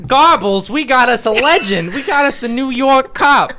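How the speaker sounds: high, loud starstruck exclaiming; small-town cop impressed by the New York detective; awed local cop. Acted. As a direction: gush over the big-city hero.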